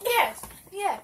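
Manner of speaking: loud excited yes; shouting. Real, not acted. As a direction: shout it out twice.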